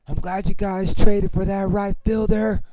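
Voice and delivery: loud, high heckle; yelling out. Retold or imitated. Fan taunting the other team about their right fielder, yelling out. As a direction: yell it across the stands. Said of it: "Peter yells out".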